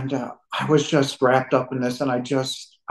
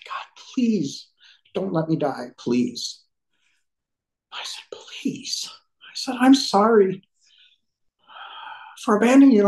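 tearful plea; 5 semitones higher than usual. Real, not acted. Man retelling his near-death prayer to God, tearful plea. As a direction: plead through real tears, voice failing. If I get emotional on you.

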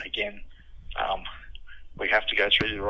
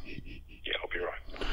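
whisper; 3 semitones lower, 12 dB quieter, much slower than usual. Real, not acted. Boss whispers refusal of coffee.

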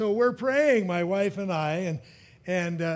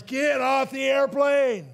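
retold scream; 6 semitones higher, 3 dB louder, slower than usual. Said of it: "screaming at the top of his lungs".

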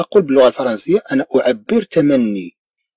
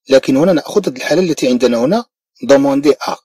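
normal talking voice

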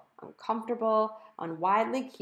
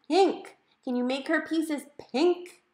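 high and loud, pink hammered; emphatic correction; 9 semitones higher, 6 dB louder, slower than usual. A narrator's reading. Mom repeating the word pink to the computer. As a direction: spell it out for a dim machine.

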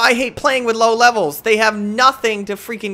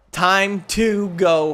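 slow drawn-out; 2 semitones lower, much slower than usual. Shown as a demonstration. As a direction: stretch every word out very slowly. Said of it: "repeated slowly".

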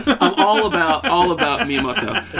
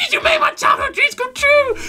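very high, loud squealing cry; overjoyed squeal; 4 dB louder than usual. Retold or imitated. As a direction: squeal it out in delight. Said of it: "I'm like".

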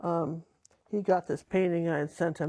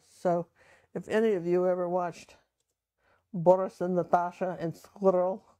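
ordinary talk

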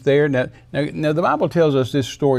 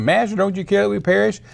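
higher-pitched urgent plea; pleading disciples; 7 semitones higher than usual. Acted. Disciples waking Jesus in storm.

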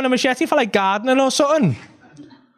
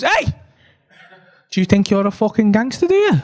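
loud confrontational shout, leaping up at the end; acted aggressive taunt; threatening challenge; 3 dB louder than usual. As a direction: square up and challenge him. Weirdo challenges man in street.

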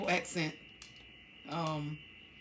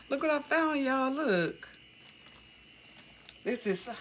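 much higher, excited discovery; 10 semitones higher than usual. Real, not acted. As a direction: show off the find, thrilled. Excited find in the shop bag.

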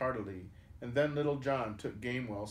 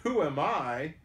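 amused, raised and louder retort; laughing reply; 4 semitones higher, 6 dB louder than usual. A narrator's reading. The youth in scarlet answers Robin's question, lightly laughing. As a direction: toss the question back with a touch of mirth. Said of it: "laughed the youth in scarlet".

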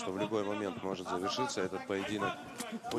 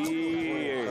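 surprised; 8 semitones higher, 6 dB louder than usual. Real, not acted. Sudden hit in the fight.

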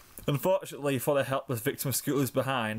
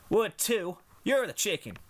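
put-on childish bickering; 8 semitones higher than usual. Childish argument.

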